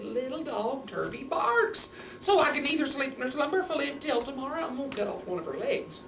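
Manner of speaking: put-on high, swooping old man's complaint; grumbling old man. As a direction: raise the pitch and stretch the complaint. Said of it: "and he said".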